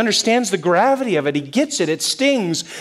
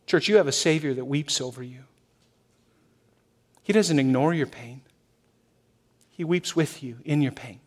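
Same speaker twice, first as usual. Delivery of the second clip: tender comfort; 4 semitones lower, 5 dB quieter than usual. Real, not acted. Pastor comforting church about suffering.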